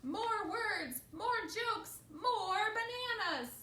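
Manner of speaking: high, loud, drawn-out wail; unhappy plea. A narrator's reading. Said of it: "does he seem very happy".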